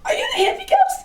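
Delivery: giddy ghost hunter thrilled by the answer; laughing excited question. Retold or imitated. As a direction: squeal it out, half laughing.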